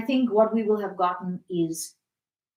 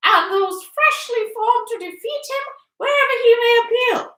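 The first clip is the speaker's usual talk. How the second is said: very high, loud declamation; hammed-up high voice reading an appeal; high-pitched reader; 12 dB louder than usual. A narrator's reading. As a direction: proclaim it shrilly, pitched right at the top. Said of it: "in her high-pitched voice".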